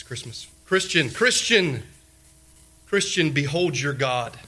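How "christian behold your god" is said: ordinary delivery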